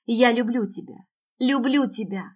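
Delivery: desperate, tearful acted outburst; tearful cry. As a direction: cry it out as if through tears. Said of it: "сквозь слёзы выкрикиваю".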